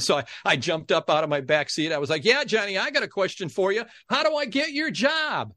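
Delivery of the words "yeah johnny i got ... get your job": high, eager question to the stage; eager call-out. Retold or imitated. Eager kid calling out to the warm-up host from the audience. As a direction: pipe up high from the back row.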